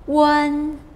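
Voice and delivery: "wen" held high and level; first tone. Shown as a demonstration. Teacher demonstrates the word for warm, "wen" in high level tone. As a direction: keep "wen" on one high, steady pitch. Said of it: "the high tone".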